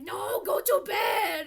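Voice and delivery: furious cartoon villain shout; villain yelling. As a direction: bark the order like an annoyed babysitter. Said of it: "Mojo Jojo was just like".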